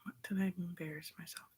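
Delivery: breathy whisper to herself. Real, not acted. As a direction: murmur the same question under her breath. Repeats question aloud then whispered.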